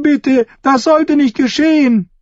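sehr hoch herausgeschrien; flehender Schrei. A narrator's reading. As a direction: schrei es wie ein verzweifeltes Mädchen. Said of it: "rief ein Mädchen". (verzweifeltes, hohes Flehen)

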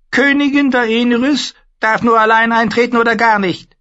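very high, loud, eerie warlock voice; a thin, uncanny sorcerer. Acted. The warlock Pyat Pree bars the way, high and eerie.

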